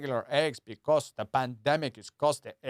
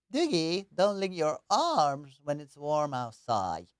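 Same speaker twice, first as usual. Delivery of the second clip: slow goofy pet-talk voice; slow, silly voice for the dog; Doogie voice; slower than usual. Acted. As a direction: scold the dog gently in a silly drawl. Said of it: "That's how I talk to Doogie".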